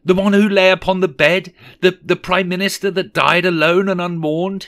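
high, loud theatrical horror; anguished cry. A narrator's reading. Scrooge seeing his own grave, crying out. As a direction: cry out the questions in rising alarm. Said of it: "he cried".

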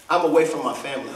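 very low, quick reply as John; understated grief in a sunken pitch; weeping John's answer. Acted. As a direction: confess it low and subdued. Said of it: "he's sobbing uncontrollably".

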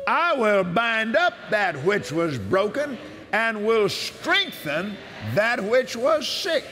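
slow, solemn reading of God's promises; measured devotional scripture reading; scripture proclamation. Acted. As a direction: proclaim each verse as a promise. Reads healing scriptures aloud.

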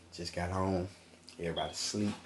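normal talking voice

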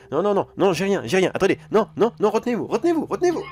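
rising panic, pitch climbing high; panicked pleading. Acted. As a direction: frantically beg them to hold it. Panics as victim about to vomit.

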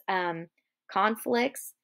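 usual speaking voice

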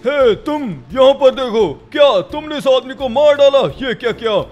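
stern accusation. Acted. Policeman accusing a man of murder, stern loud demand. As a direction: confront the suspect hard.

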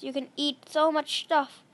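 ordinary talk, nothing marked

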